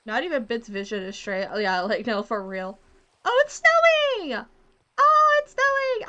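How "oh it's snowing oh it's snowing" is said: very high, loud, drawn-out cry of delight; delighted squeal. Real, not acted. Delighted as snow starts in game.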